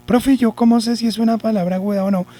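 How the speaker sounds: high-pitched put-on child asking the teacher; pupil's voice. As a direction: mimic a pupil in a high, steady voice. (voz aguda imitando a un alumno)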